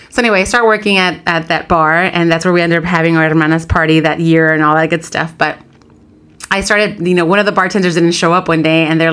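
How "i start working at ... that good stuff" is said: ordinary talk, nothing marked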